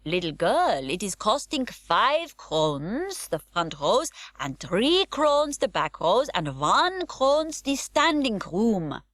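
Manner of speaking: put-on a foreign old lady pricing tickets in broken English; foreign accent. A narrator's reading. Foreign old lady quoting circus prices, slow foreign accent. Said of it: "from a foreign country".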